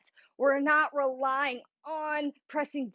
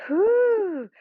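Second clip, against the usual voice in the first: relieved whew; 10 semitones higher, 8 dB louder than usual. Real, not acted. After hard balance challenge.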